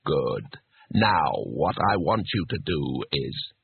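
low, slow, deliberate instruction; patient. A narrator's reading. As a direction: spell it out with strained patience, deep and slow. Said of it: "disembodied voice patiently".

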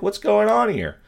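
high-pitched baffled question; mock bewildered cop, voiced high; puzzled.

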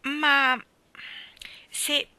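put-on a little boy dying to know the secret; high eager boy's question; eager child Davy. Davy asks, fascinated.

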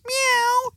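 high imitated miaow; cat meow. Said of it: "meow means".

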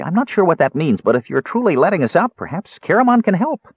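high-pitched, tentative offer; dubious. A narrator's reading. Kender offering his friend's help, dubious. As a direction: venture it doubtfully, high. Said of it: "Tass said dubiously".

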